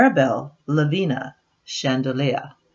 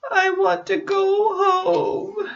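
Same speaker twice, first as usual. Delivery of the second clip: higher, slow whiny fake sob; mock crying; slower than usual. Nancy embarrassed after the spill, higher slow mock-sob. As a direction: whimper it like a tearful child.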